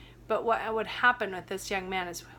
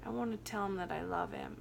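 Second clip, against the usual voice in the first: much softer, gentle quoting of him; soft tender confession; 8 dB quieter than usual. Retold or imitated. Retelling a crying client's words to his inner child, soft and tender. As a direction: hush it, like a man near tears.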